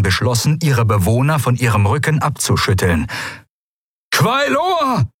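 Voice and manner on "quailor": very high, urgent yell; bellowing. Acted. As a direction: yell the name in alarm. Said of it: "brüllte die Stimme des Admirals". (alarmiertes, hohes Brüllen)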